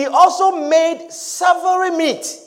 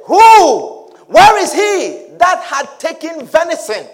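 loud, high demanding Who; shouted shocked question; alarmed outcry; 4 semitones higher, 5 dB louder, slower than usual. Acted. Isaac demands who took blessing.

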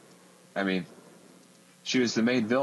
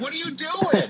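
squeaky, much higher laughing mock alarm; shocked instructor; 3 dB louder than usual. Instructor seeing student hit eighty, high laughing mock shriek. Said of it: "he's like".

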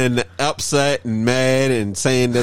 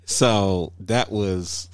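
slow and softer; slow, satisfied; 5 semitones lower, 5 dB quieter, slower than usual. Real, not acted. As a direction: savor it slowly. Satisfied after clip.

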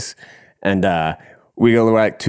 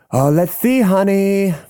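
high, campy imitated drag queen; 10 semitones higher, slower than usual. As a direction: stretch it out as a hostess indulging someone. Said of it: "the big ass drag queen is like".

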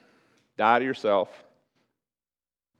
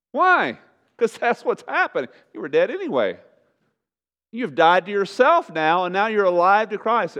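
raised, high, forceful pulpit voice; impassioned preaching; 7 semitones higher, 6 dB louder than usual. Real, not acted.

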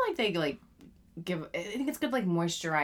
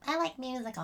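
put-on higher, little-kid voice; lightly voiced child; a hint of a child; 7 semitones higher than usual. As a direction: sound like a kid announcing a passion. Quoting child self.

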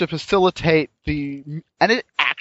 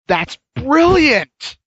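excited; 8 semitones higher, 5 dB louder, much slower than usual. Real, not acted. Delighted reaction to game.

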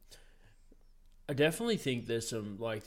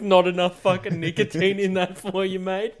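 high, louder teasing jab; a light mock ribbing, laughing at the end; mock teasing mate; 7 semitones higher, 10 dB louder than usual. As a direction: rib him like a cheeky mate. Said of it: "It's like".

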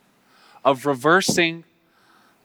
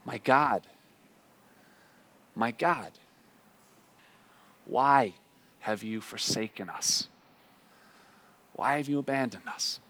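hushed lament; 5 dB quieter than usual. Acted. Preacher voicing the congregation's cry to God, hushed and aching. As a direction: whisper the cry as if it were our own.

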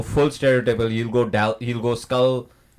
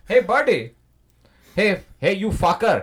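put-on Indian accent; 5 semitones higher than usual. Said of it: "Indian Mister Freeze".

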